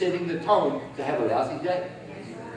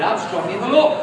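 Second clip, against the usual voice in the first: firm and louder, with conviction; firm declaration; said like you mean it; 8 dB louder than usual. Shown as a demonstration. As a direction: declare it, don't just recite it. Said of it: "Say it like you mean it".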